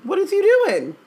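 loud, high-pitched incredulous rebuke; exasperated scolding. Real, not acted. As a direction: scold a champion who is letting everyone down. Scolding the absent champion.

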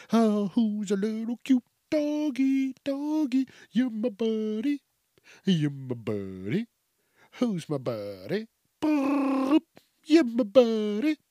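high sing-song doggy talk; baby talk to dog. Retold or imitated. Owner cooing at his cute doggy in baby talk. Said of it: "in that pet voice".

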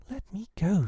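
put-on high, insistent girl's command; ordering. A narrator's reading. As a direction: order it firmly without shouting. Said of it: "she ordered him".

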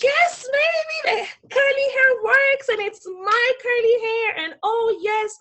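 high, loud triumphant whooping; stretched-out, high-pitched jubilant self-hype; exultant celebration. Retold or imitated. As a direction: celebrate yourself like you own the street. Reliving walking out feeling hot.

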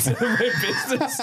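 laughing shout; laughing while echoing the line. Real, not acted.

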